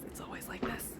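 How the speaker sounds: breathy confession under her breath; whispered aside. Real, not acted. Admitting to viewers her house is always a mess, whispered. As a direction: whisper the truth to the camera.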